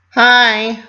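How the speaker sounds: high, loud burst of a hi; bright greeting. A narrator's reading. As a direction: bounce in with a big hi. Pinky bursts through door.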